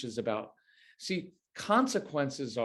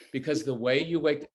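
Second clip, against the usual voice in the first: normal talking voice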